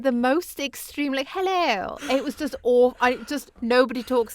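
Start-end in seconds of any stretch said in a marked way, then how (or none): 1.3-2.0 high plummy RP hello, hammed up. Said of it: "I sounded like the fucking Queen".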